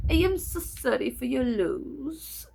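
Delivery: put-on very slow French-sounding accent; imitated South African accent. Psychiatrist hearing mom passed away, imitated French-sounding accent. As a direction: draw it out in a mimicked French-tinged accent. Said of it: "That's how he sounds".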